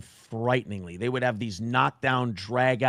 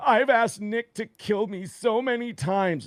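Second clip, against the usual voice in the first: higher, slow mock voice; mock confession; 7 semitones higher, slower than usual. Quoting her claim that Nick should kill her, slow and mocking. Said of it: "she goes".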